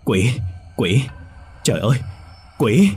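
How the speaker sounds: shrieking in terror at the doll; terrified screaming. A narrator's reading. Old woman screams at the bloody doll, terrified. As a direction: cry out in panic, rising to a scream. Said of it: "bà Hai hét lớn trong vô vọng".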